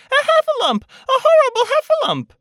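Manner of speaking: very high, loud alarmed squeal; terrified little pig's shriek; Piglet crying out. A narrator's reading. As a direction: raise the alarm in a tiny squeaky voice. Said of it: "cried Piglet".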